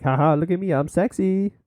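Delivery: mock a vain poster showing off. Imagined photo faker bragging to viewers, mocking show-off.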